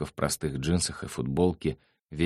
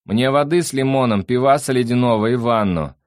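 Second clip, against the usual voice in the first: loud, raised order; calling from afar; 7 semitones higher, 6 dB louder than usual. A narrator's reading. Sandro orders drinks and a bath from afar, calling loudly. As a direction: order drinks from another room. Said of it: "издалека послышался его голос".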